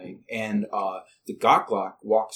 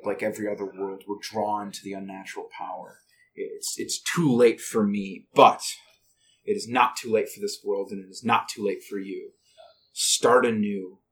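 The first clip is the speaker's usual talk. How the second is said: dying wizard's last words; 3 semitones lower than usual. Acted. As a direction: hand on the torch with the last breath. Old wizard's dying speech.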